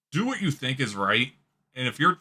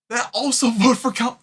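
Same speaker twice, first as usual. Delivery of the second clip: normal talking voice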